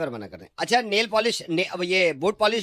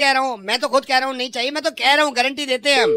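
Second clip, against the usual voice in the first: higher, louder indignant insisting; loud protest; 10 semitones higher, 7 dB louder, faster than usual. Acted. Shopkeeper loudly insisting to the annoyed customer, indignant. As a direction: protest it loudly, talking over him.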